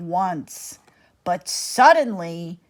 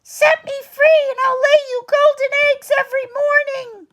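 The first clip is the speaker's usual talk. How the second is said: very high, fast hen pleading; the golden hen begs Jack, fast and high; clucking hen; 5 dB louder, much faster than usual. Acted. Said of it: "she clucked".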